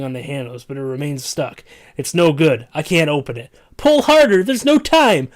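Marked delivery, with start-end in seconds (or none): 3.8-5.3 panicked shout. Acted. Panicked escape at stuck door.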